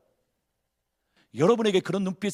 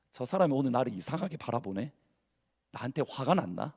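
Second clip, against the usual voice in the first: anxious inner thought; 6 semitones lower, 9 dB quieter than usual. Retold or imitated. Worried churchgoer's inner thoughts.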